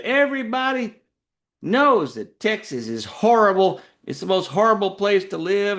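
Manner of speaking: high-pitched imitation of a ranting woman; mock screaming. Tipsy Californian knocking Texas, mock screaming. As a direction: draw out the complaint in a high mock wail. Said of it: "She was just screaming".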